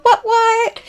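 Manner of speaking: high playful "What, what?" shout-out; hype call. Pretend. Greeting her friend Rose with an excited high what what. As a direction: pump up the name with a squeal.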